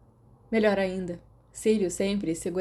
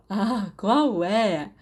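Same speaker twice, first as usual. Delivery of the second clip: laughing; 2 semitones higher, 5 dB louder, much slower than usual. Acted. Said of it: "O moreno ria".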